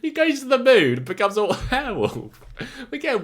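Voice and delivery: an excited, gleeful repeat of the joke; delighted. Real, not acted. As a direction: relish every word of it.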